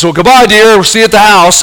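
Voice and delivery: a dad joking as he shoves the boat off; teasing goodbye. Retold or imitated. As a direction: wave the kid off with playful fake sweetness. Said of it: "And he says".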